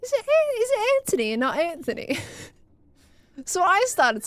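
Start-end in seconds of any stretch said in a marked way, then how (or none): 0.0-2.2 high-pitched mock bewilderment, Anthony first with T, then with TH; baffled, high-voiced query; retold puzzled question. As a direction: act out your own confused double-check, pitched high. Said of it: "I was like".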